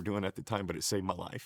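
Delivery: soft, low and choked; barely getting the words out, real emotion. Real, not acted. Husband telling wife the family saved his life, choked up. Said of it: "It's where I get choked up the most".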